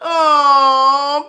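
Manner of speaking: high, loud helpless laughter; real laugh tipping into a cry; hard laugh. Real, not acted. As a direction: break up laughing at the comment. Laughs at a viewer comment.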